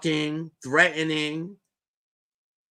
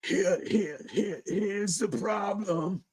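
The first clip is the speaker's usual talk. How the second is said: slow, mocking echo of his stammer; mocking echo of the stammered phrase; mocking imitation; 5 semitones higher, 5 dB quieter, slower than usual. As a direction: parrot him back slowly, teasing. Mimics S2's phrase.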